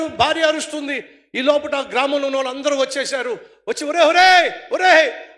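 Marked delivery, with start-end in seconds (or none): 3.9-5.3 loud villagers' call. Acted. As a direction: call across the water. (ఊరివాళ్ళ అరుపు)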